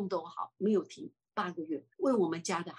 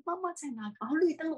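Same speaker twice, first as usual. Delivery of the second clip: ordinary talk, nothing marked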